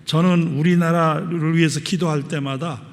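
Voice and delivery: loud pulpit declamation; forceful preaching. Real, not acted. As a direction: proclaim it firmly to the congregation. Scripture reading and exhortation.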